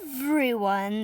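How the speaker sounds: ordinary delivery, nothing marked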